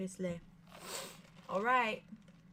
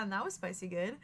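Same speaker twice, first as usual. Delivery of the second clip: ordinary talk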